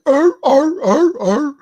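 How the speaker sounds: high loud barking arr arr like a sea lion; sea lion barks. Retold or imitated. Imagining a sea lion in a sewer, high loud barks. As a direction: bark it out like a sea lion popping up.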